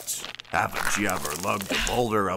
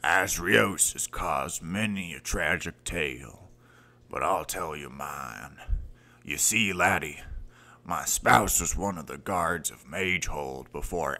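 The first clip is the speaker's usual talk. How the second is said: somber confession; 2 semitones lower than usual. Acted. Mentor tells tragic tale.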